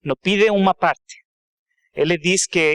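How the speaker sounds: Portuguese with a Spanish accent; non-native Portuguese, Spanish accent. Real, not acted. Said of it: "então desculpe meu português. Eu falo mel-, melhor inglês e espanhol".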